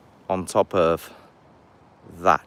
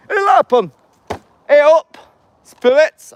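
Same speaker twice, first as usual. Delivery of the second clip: very high, very loud Yorkshire yell; Yorkshire shout; 11 dB louder, slower than usual. Pretend. Vlogger calling spirits in a sudden loud Yorkshire shout. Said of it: "I don't know why I'm Yorkshire all of a sudden".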